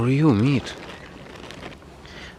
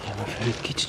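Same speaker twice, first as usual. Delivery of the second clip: whispering; 9 dB quieter, faster than usual. Real, not acted. Quiet aside to cat.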